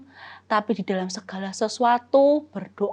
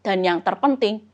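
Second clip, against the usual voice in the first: ordinary delivery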